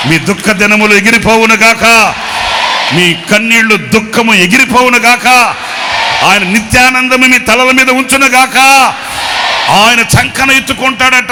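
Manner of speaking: loud, ringing preacher's benediction; fervent blessing. Acted. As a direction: proclaim it over the crowd at full force. Shouted blessing over congregation.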